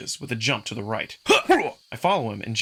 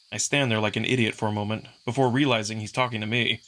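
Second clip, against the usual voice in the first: ordinary delivery, nothing marked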